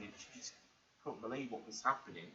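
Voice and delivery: ordinary talk, nothing marked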